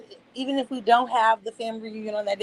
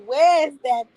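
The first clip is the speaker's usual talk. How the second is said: cooing neighbours; 3 semitones higher, 5 dB louder, slower than usual. Retold or imitated. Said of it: "everyone is like".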